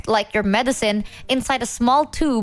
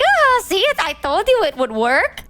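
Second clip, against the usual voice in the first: high, loud, smug gloating mom; 8 semitones higher, 4 dB louder than usual. Retold or imitated. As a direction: crow about the trick working. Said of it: "laugh like a psychopath".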